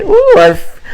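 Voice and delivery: sudden high squealing ooh. Acted. Host squealing at fans mid sign-off. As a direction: shriek up into the ooh.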